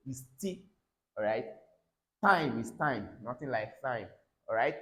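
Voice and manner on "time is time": ordinary talk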